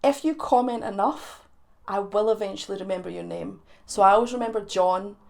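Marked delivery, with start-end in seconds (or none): none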